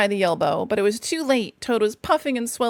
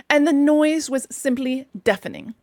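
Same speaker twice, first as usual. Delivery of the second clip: ordinary delivery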